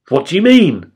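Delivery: exclaimed. A narrator's reading. Nicodemus questioning Jesus, exclaiming in surprise. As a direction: exclaim it in disbelief. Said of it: "exclaimed Nicodemus".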